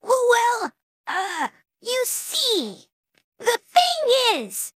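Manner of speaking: high, halting, drawn-out character voice; embarrassed stammer. A narrator's reading. Caught-out pony struggling to explain herself to fan, stammering. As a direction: stall with stretched, faltering words. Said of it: "she stammered".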